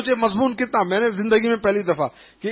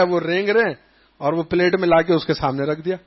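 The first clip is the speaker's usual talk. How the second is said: normal talking voice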